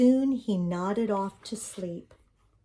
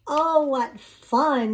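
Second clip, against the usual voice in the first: slow, higher and louder with delight; smiling, slow delight; with a smile; 5 semitones higher, 5 dB louder, much slower than usual. A narrator's reading. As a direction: savour the fun, smiling. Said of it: "he said with a smile".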